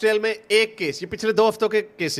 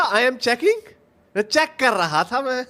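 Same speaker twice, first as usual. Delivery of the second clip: mock disbelief; 7 semitones higher, slower than usual. Reactor echoes the caught man's checking excuse, mocking disbelief. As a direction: throw the excuse back in mockery.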